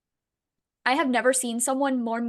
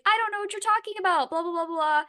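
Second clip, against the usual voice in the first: mock innocent denial; 8 semitones higher, faster than usual. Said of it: "And she's like".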